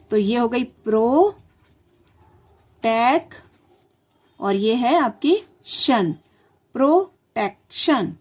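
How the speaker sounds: प्रो, टेक, शन said apart, then प्रोटेक्शन slowly; slow syllables joined at the end; slow chunking. Shown as a demonstration. Teacher splits protection into parts, slowly. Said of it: "प्रो अलग लिखेंगे".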